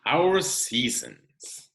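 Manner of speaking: expressive picture-book read-aloud; reading to children. Acted. Teacher reading a seasons book to her class, expressive read-aloud. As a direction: read it out like story time for the class.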